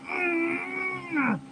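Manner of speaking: high, soft strained whine; drawn-out exasperated "mm"; frustrated groan. Real, not acted. Exasperated, at a loss for words.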